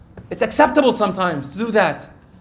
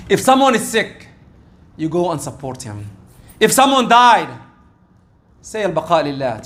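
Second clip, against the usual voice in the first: ordinary talk, nothing marked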